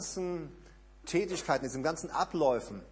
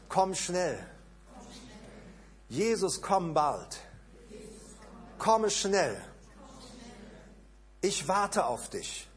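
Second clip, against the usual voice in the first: led prayer. Acted. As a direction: lead the congregation in longing prayer. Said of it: "Lass uns das doch mal zusammen so sagen".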